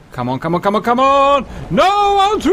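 high, rising desperate cries; desperate urging. Real, not acted. Urging, then crash cry.

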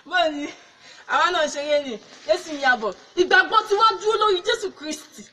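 loud, emphatic face-saving proclamation; resumed preaching. Acted. Evangelist claiming faith in Christ after fleeing, loud preaching. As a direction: declare faith boldly to the onlookers.

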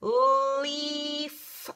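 leaf held long and slow, sounding out; stretched modeling word. Shown as a demonstration. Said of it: "Let's say it again".

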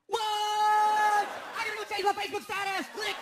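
high, loud, slow gasping rush; hammed-up giddy cousin; frantic excitement. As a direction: freak out like a superfan. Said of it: "he goes".

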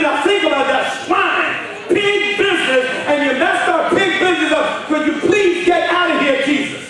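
loud, high fired-up sermon; preaching at full force. Acted. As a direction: preach it out to the whole room. Preaching to congregation.

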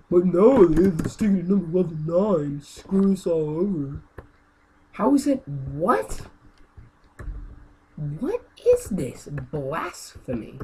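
loud, slow disgusted complaining; mock-outraged rant. Real, not acted. Gamer complaining the hard level is eleven, slow outrage. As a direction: drag out the indignation. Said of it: "this is me raging".

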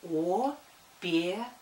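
slow syllable-by-syllable spelling; dictating. Shown as a demonstration. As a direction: draw out «О-пе-ра» slowly, each syllable apart. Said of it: "Пишу". (медленно диктует по слогам)

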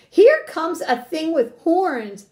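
high, loud, slow rabbit warning; drawn-out alarm call; storybook shout. A narrator's reading. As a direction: shout it out word by word. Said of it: "shouted the rabbit".

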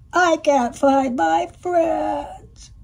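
high, slow, teary; tearful whimper. Acted. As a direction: plead through tears, slowly. Lost duck cries to hen.

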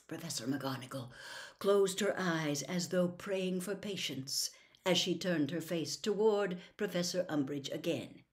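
ordinary delivery

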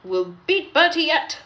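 rally the engine; spirited resolve; determined driver. A narrator's reading. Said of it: "said his driver".

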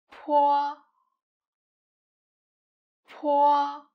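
"po" with a strong puff of air on the p; breathy aspirated "po". Shown as a demonstration. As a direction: blow air out on "po". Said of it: "Po is aspirated".

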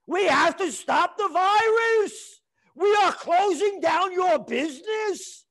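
sneering imitation of a government order; mock official decree. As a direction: ham up a bureaucrat shutting you down. Mocking governments' lockdown orders.

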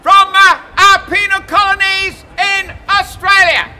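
loud proclaiming greetings; town crier. Acted. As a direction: greet each nation like a crier.

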